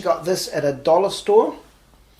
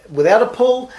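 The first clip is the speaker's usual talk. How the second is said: "without a pull" lifted high and louder; stress on without; 8 semitones higher, 8 dB louder than usual. Shown as a demonstration. Magician to viewers, contrasting methods, stressing "without".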